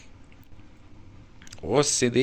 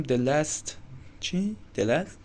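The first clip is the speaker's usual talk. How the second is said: ordinary talk, nothing marked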